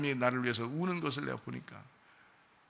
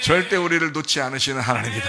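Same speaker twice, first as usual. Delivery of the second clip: very loud preaching climax; impassioned proclamation; 9 dB louder than usual. Real, not acted. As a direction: drive it home to the whole congregation. Preaching climax answered by amens.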